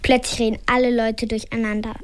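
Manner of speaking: plain reading voice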